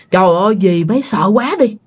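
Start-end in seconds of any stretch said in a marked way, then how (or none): none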